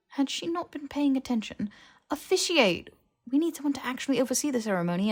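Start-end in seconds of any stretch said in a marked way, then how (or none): 2.1-5.1 impatient retort. Acted. Harry impatient she missed the point.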